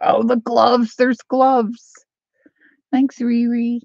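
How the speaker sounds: high, loud delighted outburst; excited discovery. Real, not acted. Vlogger spotting gloves and thanking a viewer, excited discovery. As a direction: exclaim over a happy find. Finds gloves among the bundle.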